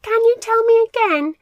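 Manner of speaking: small nervous squeak of a question; timid little mouse. A narrator's reading. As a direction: Milo the fearful mouse, squeaky and unsure. Timid Milo asks.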